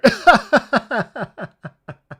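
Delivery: loud, high laugh tumbling down; uncontrollable laughter. Real, not acted. Streamer apologising to viewers, breaking into uncontrollable laughter. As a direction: burst out laughing mid-apology.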